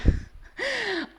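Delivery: a sudden high burst of real laughter. Real, not acted. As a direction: laugh it off, amused at herself. Laughs at own Chinese learning.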